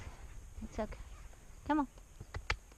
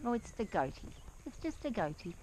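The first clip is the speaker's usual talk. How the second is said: ordinary talk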